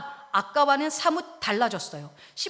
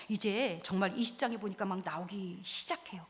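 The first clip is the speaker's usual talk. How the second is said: ordinary talk, nothing marked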